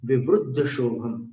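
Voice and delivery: विवृद्ध as 'vivruddha', with u; u after the r in विवृद्ध; u pronunciation. Shown as a demonstration. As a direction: say विवृद्ध with 'vru'. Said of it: "अंतर पड़ता है".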